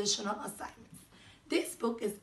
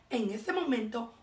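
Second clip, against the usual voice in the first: usual speaking voice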